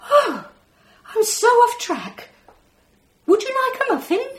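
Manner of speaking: high, loud, quick flustered alien. Acted. Alien caught off track blurting an offer, high and flustered. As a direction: blurt it out like a rattled little alien.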